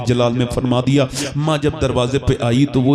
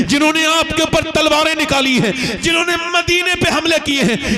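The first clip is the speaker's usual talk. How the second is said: high, shouted preaching climax; climactic shout; 14 semitones higher than usual. Acted. Sermon climax shouting prophet's words.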